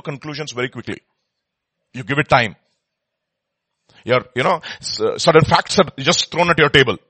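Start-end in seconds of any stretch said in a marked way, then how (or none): none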